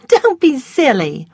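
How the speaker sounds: a touch of petulant Scarlett. A narrator's reading. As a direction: brush him off with a high scoff. Scarlett voiced in story.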